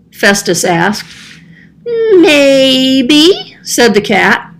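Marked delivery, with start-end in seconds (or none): 1.8-3.6 put-on high, childlike cat voice; a playful little cat, a bit puzzled. Cat answering the man.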